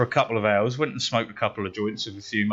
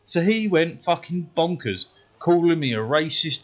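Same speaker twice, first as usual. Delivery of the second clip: ordinary delivery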